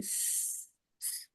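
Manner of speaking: a sustained whistling sss with no vowel; whistling hiss. Shown as a demonstration. Teacher demonstrating the whistle of the letter sin, sustained hiss. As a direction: hold a thin hissing s like a whistle. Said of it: "سین میں سیٹی کی آواز نکلتی ہے".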